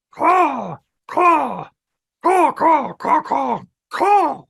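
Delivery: very high, loud crow caws. A narrator's reading. Old crow calling to the pigeons, loud cawing. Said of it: "the old tattered crow said".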